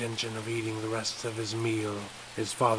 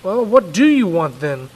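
louder, exasperated dad; weary father; 6 semitones higher, 12 dB louder than usual. Acted. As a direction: ask with thinning patience. Father, patience thinning.

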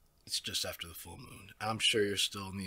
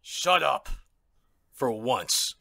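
sharp, loud, higher snap to silence her; angry order; 5 semitones higher, 10 dB louder, much slower than usual. Acted. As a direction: cut her off hard and loud. Villain silences the captive.

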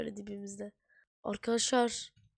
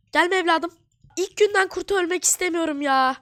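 loud high frightened yell; panicked shouting; 7 semitones higher, 11 dB louder than usual. Real, not acted. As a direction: shout while fending off an attack. Wolf attacks on day one.

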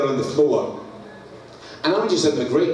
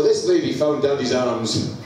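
ordinary talk, nothing marked

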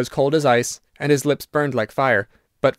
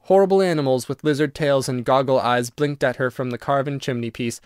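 ordinary delivery, nothing marked

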